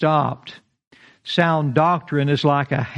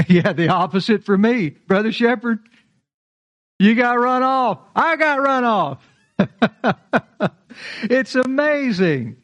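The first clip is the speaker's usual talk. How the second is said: laughing reply; 7 semitones higher than usual. Real, not acted. Second speaker answering, laughing.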